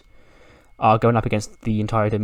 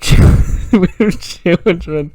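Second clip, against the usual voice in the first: laughing; 7 semitones higher, 8 dB louder, much slower than usual. Real, not acted. Laughing at the absurd moment.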